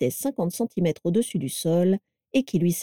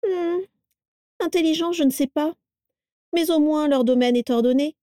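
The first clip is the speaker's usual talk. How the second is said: higher, sneering, disdainful; grimacing disdain; 8 semitones higher than usual. A narrator's reading. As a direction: turn up the nose at it. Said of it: "grimaça Carla".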